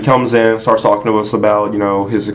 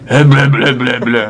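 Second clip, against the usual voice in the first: mocking impression; slower than usual. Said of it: "this was his impression of the guy".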